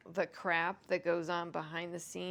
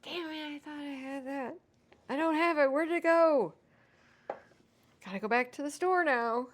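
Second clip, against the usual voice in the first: higher and louder as she realizes it's gone; mock frustrated rant; 7 semitones higher, 7 dB louder than usual. Retelling her own missing-ingredient panic, mock frustrated rant. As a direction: replay the kitchen panic, building up. And going.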